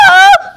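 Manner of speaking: sudden shrill shriek; high scream. Acted. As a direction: let out a piercing scream. Character screams.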